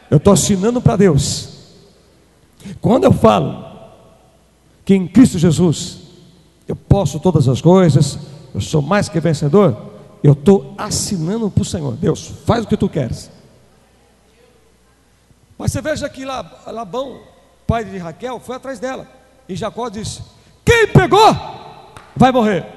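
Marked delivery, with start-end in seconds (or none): 0.1-13.3 preaching at full force. Acted. Preacher warning the church against careless words, fiery shout. 20.6-22.7 loud, high oath shouted as Jacob; stern oath. Retold or imitated. Jacob swearing to Laban that the thief will die, loud solemn oath.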